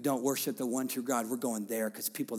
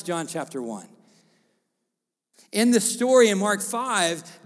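loud, higher commanding preaching; full-force preaching; 6 semitones higher, 8 dB louder than usual. Acted. Pastor proclaiming Jesus's command, loud preaching. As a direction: declare it over the room.

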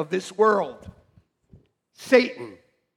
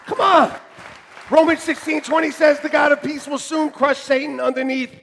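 loud, high preaching; a loud, fired-up sermon climax; preaching at full force; 8 semitones higher, 7 dB louder than usual. Real, not acted. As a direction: drive it home from the pulpit.

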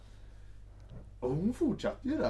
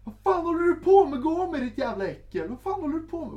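very high, loud shriek of rage; furious woman; 13 dB louder than usual. Retold or imitated. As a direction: scream at him in disgust. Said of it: "Och så bara". (hög, skrikig spelad ilska)

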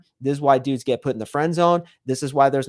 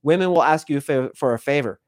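ordinary talk

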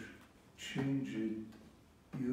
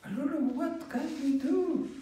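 much higher, fast, desperate; panicked sailors; 11 semitones higher, 3 dB louder, faster than usual. Acted. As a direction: blurt it out in helpless panic.

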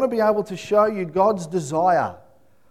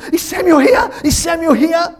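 high, loud panicked shout; frantic high shouted question; 11 semitones higher, 7 dB louder than usual. Retold or imitated. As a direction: burst in asking for a missing son. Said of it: "she runs in and she goes".

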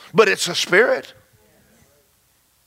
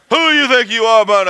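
louder, higher mock outburst; angry boss; 5 semitones higher, 5 dB louder than usual. Angry boss confronting employee who bound the devil, mock outrage. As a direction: bark back like the offended boss.

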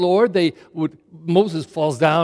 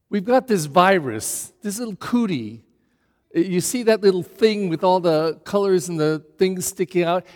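normal talking voice

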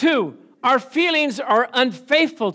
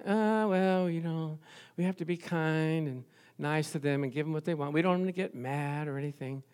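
hushed, simpering voice of a too-soft parent; mock the wishy-washy parent, softly; 6 semitones lower, 10 dB quieter than usual. Preacher mocking modern parents, a soft simpering parent voice.

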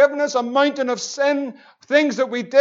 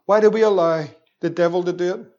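ordinary talk

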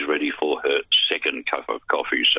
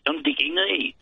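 put-on much higher cheeky school kid voice; Dickie Nee; 10 semitones higher than usual. School kid popping up to answer Daryl, high kid voice. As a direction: pop up like a little schoolboy, high. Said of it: "perfect name for my little school kid".

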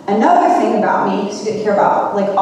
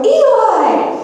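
high-pitched retold cry of the name; alarmed calling out; 12 semitones higher, 3 dB louder than usual. Retelling catching a friend's son with the muffin, alarmed call. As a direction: call out sharply high, re-enacting dismay. Calls out at child eating her muffin.

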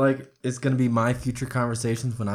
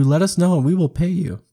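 ordinary talk, nothing marked

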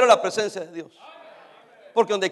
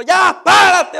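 stern call to get up; 8 semitones higher, 9 dB louder, much slower than usual. Acted. Pastor mock-scolding a complainer.

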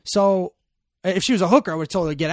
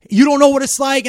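higher, louder retold angry outburst; 9 semitones higher, 12 dB louder than usual. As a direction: throw it back at him in anger. Angry friend retold in car.

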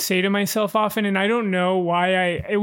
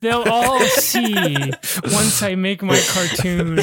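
mock vengeful vow; slower than usual. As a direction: swear hammed-up revenge, slow and laughing. Mock spiteful vow, riffing.

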